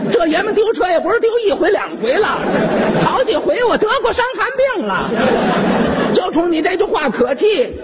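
high, indignant scolding; high, indignant scolding of the soldier; angry yelling. Acted. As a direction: tear into him, outraged. Uncle furious at the soldier.

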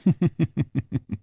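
a villain's haughty laugh; big mocking laugh. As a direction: laugh him off with contempt. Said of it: "大笑地说".

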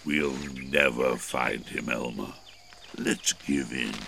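subdued, deep-pitched and resigned; discouraged elephant. A narrator's reading. Weary elephant gives up searching.

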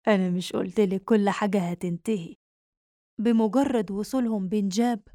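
plain reading voice

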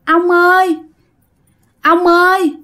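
put-on high, drawn-out pleading child's voice; whiny little child. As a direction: play a small ghost child coaxing grandpa. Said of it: "nói theo cái kiểu nhõng nhẽo". (giọng con nít nhõng nhẽo, cao và kéo dài)

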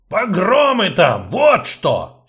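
barked, loud and slow; sudden furious bark. Acted. Gnome barks at sorceress about pogroms. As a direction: snap with sudden fury. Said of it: "со внезапной яростью рявкнул он".

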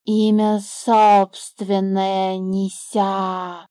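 slow drawn-out snake voice; the snake rejecting the bird's word; scornful snake. A narrator's reading. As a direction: drag it out like a lazy serpent.

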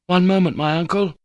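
loud cry to someone far off. Acted. Nephew crying out to his distant uncle, loud. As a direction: call out to hold him back. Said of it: "I cried".

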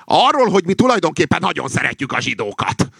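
high, loud rooster crow of a line. A narrator's reading. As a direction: crow it out at the top of the voice. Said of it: "kukorékolta a kakas". (magas, hangos kukorékolás)

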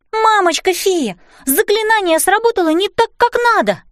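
put-on high-pitched child's cry of alarm; exclaiming. A narrator's reading. Girl telling fairy mother the spell went wrong, exclaiming. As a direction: exclaim urgently, pitched well up. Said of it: "воскликнула я".

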